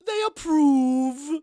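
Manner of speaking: high, loud, drawn-out ghostly proclamation; ghostly madam. Acted. Ghost reports the spirits' verdict, in a high eerie wail. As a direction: wail it slowly like an entranced medium. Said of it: "and she goes".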